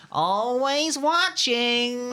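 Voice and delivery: slow, drawn-out creepy imitation; a hint of Bill Cipher. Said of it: "reminds me of, um, Bill Cipher".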